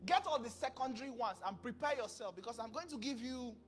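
ordinary talk, nothing marked

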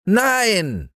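put-on high, defiant child's yell; boy yelling no. Häwelmann refusing the moon, high defiant child yell. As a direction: shout it like a stubborn little boy. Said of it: "schrie der kleine Häwelmann".

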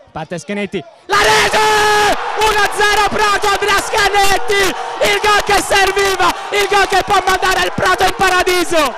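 high-pitched shouted goal call, breathless; breathless italian goal commentary. Acted. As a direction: yell the goal like a stadium commentator. Live goal call, penalty and goal.

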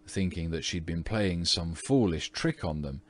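ordinary delivery, nothing marked

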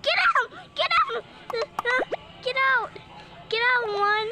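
loud yelled command; shouting. Real, not acted. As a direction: yell them off your base. Shouting at player in game.

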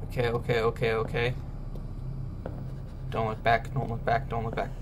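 hushed chant to keep calm; muttered nervous mantra; hushed self-reassurance. Real, not acted. Alone, scared, calming himself.

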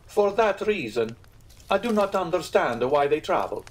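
put-out innkeeper, voice lifted; piqued. A narrator's reading. Innkeeper complaining about French travellers, somewhat piqued. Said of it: "somewhat piqued".

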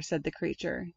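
usual speaking voice

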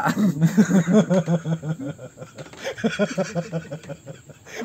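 long, loud laugh fading out. Real, not acted. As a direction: burst into real laughter, then tail off. Tertawa setelah bercanda. (tertawa lepas lalu mereda)

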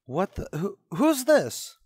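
stammered, then a sudden high cry of who's this; jolted by a stranger's voice at home; startled. Acted. Startled son asking who answered his mother's phone.

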